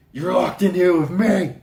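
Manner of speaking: hammed-up yell of a film line; mock movie shout. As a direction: burst out with the dramatic punchline.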